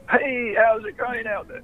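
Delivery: high, slow announcer greeting; radio DJ voice. Shown as a demonstration. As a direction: boom it out like a radio host. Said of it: "if I talk like that".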